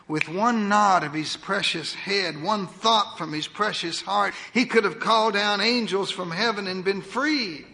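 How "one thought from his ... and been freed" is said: ordinary delivery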